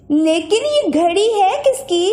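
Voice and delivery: put-on a girl wonders aloud; girl's voice. A narrator's reading. Sister asks her brother, curious girl voice.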